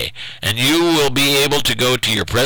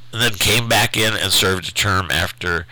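ordinary talk

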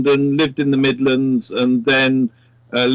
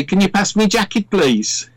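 higher-pitched Midlands impression; Midlands accent; 5 semitones higher than usual. Shown as a demonstration. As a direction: ask in a broad Midlands accent. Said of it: "they'd have this accent".

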